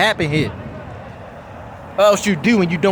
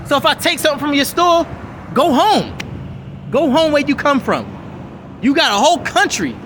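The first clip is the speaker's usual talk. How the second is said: high, heated telling-off; heated rant; 6 semitones higher than usual. Real, not acted. Ranting at the store owner, high and heated. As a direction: chew out the store owner, pitch up.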